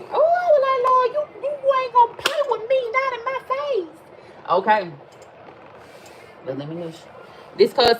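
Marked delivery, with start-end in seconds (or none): 0.0-4.5 high, squealing mock outrage, slowing at the end; squealing high exclamation. Telling the Lord off, in a high squealing mock outrage. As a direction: squeal it up high like a scandalized church lady.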